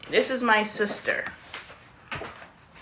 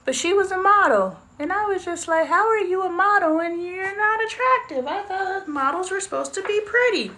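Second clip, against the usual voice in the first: mock disbelief; 10 semitones higher than usual. Mocking her younger judgmental self.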